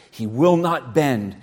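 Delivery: ordinary talk, nothing marked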